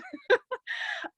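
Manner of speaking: high, tearful giggle; teary laugh. Real, not acted. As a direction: laugh through the tears. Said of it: "teary laugh after crying remark".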